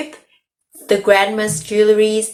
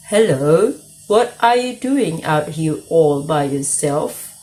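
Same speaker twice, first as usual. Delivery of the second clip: slow croaky wolf voice; croaked; slower than usual. A narrator's reading. Wolf croaking at the girl on the path. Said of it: "he croaked".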